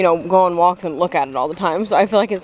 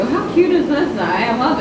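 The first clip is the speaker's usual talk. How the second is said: gushing; 6 semitones higher than usual. Real, not acted. Gushing over ornament.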